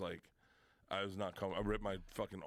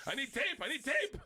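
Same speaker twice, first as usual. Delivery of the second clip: much higher, a bit louder urgent plea; mock panic; 14 semitones higher, 5 dB louder than usual. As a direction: beg for tape, hammed-up. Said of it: "And then I'm like".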